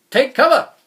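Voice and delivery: a sudden loud cry of alarm; yelled warning. A narrator's reading. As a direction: bellow the warning over the storm. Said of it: "he yelled".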